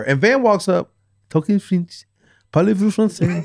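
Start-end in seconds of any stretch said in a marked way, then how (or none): 1.3-3.4 a hint of a French accent on the question; lightly mocking French accent. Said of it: "still has this French accent".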